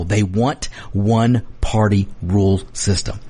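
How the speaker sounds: normal talking voice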